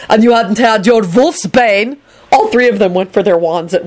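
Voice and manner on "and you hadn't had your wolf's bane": high, slow angry retort; bellowed. A narrator's reading. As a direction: throw it back higher, restrained bellow. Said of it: "Dragomir bellowed".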